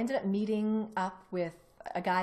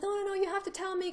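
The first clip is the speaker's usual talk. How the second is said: insistent plea; 12 semitones higher, faster than usual. Retold or imitated. Student insisting on the truth.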